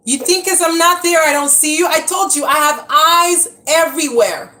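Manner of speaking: loud, high call across the yard; calling out to son. Real, not acted. Mom shouting across the yard at her son Liam.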